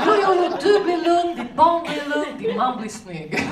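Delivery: put-on slow, sing-song Russian accent; Russian-accented coaxing. Svetlana persuading pregnant Elena to drink vodka, sing-song. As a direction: soothe her with a lilting promise.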